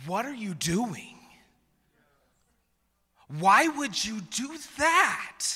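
hammed-up outraged parent; exasperated scolding. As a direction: scold in a high voice, stretching the words. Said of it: "think that God steps into that moment".

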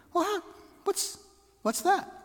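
high startled disbelief; shocked. Retold or imitated. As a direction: react to sudden bad news. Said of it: "I'm thinking".

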